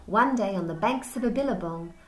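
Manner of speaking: lively storybook read-aloud; reading to children. Acted. Teacher reading Wombat Stew aloud to the class. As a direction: tell it to wide-eyed kids.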